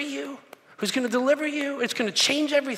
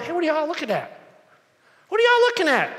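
playful Jesus; 6 semitones higher than usual. Retold or imitated. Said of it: "Jesus is sitting there going".

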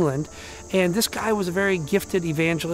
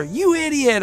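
road rage; 7 semitones higher, 4 dB louder than usual. Retold or imitated. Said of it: "the mom's like".

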